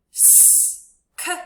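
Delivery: S-K-I spelled high and whispered; spelled out letters. Shown as a demonstration. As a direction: sound out S-K-I letter by letter. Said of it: "Sound it out with me".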